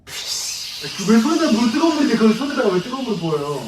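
high, loud scalded protest; loud indignant yelp. Acted. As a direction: yelp at the waiter about hot water. Scalded customer protests loudly.

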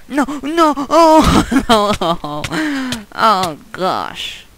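high, loud cries of alarm; real shrieking dismay; panicked screaming. Real, not acted. Caught out by danger in game.

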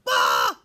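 short, loud mock scream; startled yell. Chicken Little hit by an acorn, startled yell. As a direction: yelp as if bonked. Acorn hits the puppet's head.

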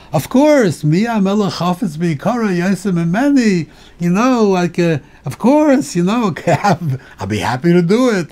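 mock eager yes. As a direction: jump at the offer, overeager. Eagerly accepting the mission, mock example.

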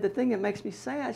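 high, soft, mock wistful; mother's voice. Son quoting mother's sad remark, high mock wistful. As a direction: sigh it out as mama.

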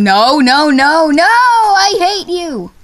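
rising to a high, drawn-out wail; frustrated yell. Real, not acted. Gamer failing the quiz question, frustrated rising yell. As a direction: build from protest to loud exasperation.

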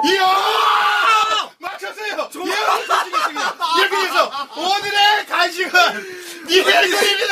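high, laughing announcement; excited high announcing. Real, not acted. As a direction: announce the result with glee. Host announcing result excitedly.

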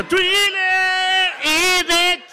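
high, loud cry of the name Thriller; shouted announcement. Real, not acted. Comedian to crowd: high shouted name. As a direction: call it out like a hype man.

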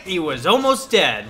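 ordinary talk

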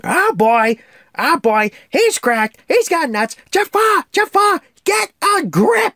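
high, loud, squawking parrot panic; loud high parrot freaking out; Iago. Retold or imitated. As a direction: squawk it like a frantic cartoon parrot. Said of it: "Iago's like".

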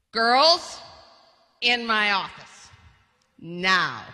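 a stern father calling his daughters in. Retold or imitated. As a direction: summon the girls like an angry dad. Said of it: "heard a tone like that".

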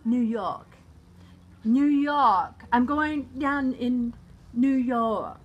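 broad New York accent, drawn out; big, stretched-out New Yorker talk. Shown as a demonstration.

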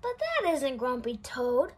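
ordinary delivery, nothing marked

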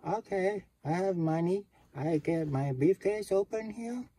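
high, eager tourist voice pitched well up; Japanese tourist voice. Acted. Eager Japanese guest talks to the concierge, high. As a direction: play the keen rich guest.

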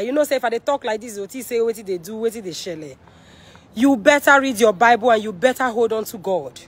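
ordinary talk, nothing marked

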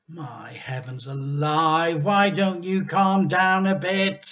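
exasperated wife. A narrator's reading. Mrs Cranky scolding her husband.

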